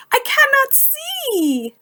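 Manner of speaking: high, distressed wail; crying out. Acted. Toad blinded by ice cream cries out helplessly. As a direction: sound lost and helpless. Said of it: "cried Toad".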